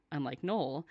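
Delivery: ordinary talk, nothing marked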